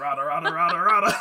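loud, laughing rada rada gibberish; a loud, hammed-up cartoon creature's gibberish; Schnitzel gibberish. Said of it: "that's how he talks".

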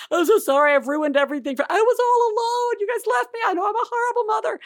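hammed-up distraught apology climbing high; mock tearful wailing. Mother and son upset after Halloween, retold as mock tearful wailing. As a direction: wail it out, voice rising as in tears.

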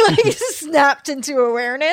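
loud, high, laughing through the words. Real, not acted. Telling the host how she jolted awake, laughing. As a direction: tell it through a big laugh.

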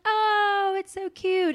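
high-pitched, gushing coo; mock cooing. Classmates delighted by the Southern student's word, high coo. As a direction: gush as if over something adorable.

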